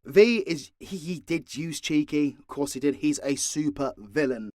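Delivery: normal talking voice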